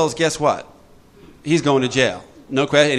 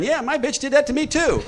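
high mocking whine; mocking member; 12 semitones higher than usual. Mocking AA members co-signing, high whiny voice. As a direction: parody the gossip. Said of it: "their characters are jumping up".

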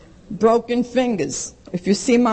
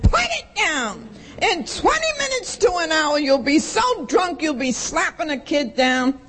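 screaming husband; 8 semitones higher than usual. Retold or imitated. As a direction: shriek it at her like the furious husband. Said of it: "And he's screaming".

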